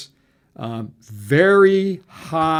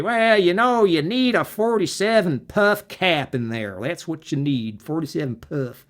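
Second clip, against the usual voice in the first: hammed-up old timer explaining parts; old guy accent; 4 semitones higher than usual. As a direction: play a gruff old engineer. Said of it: "That's my old guy accent".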